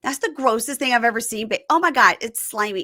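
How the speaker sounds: hammed-up grossed-out protest; mock disgusted whining. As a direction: whine like you might throw up. Acts out disgust at eating snails.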